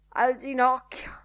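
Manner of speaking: pained groan. A narrator's reading. Lenihan rubbing his knee, mock pain. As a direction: groan it with comic hurt. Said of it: "Fece una buffa smorfia e gemette".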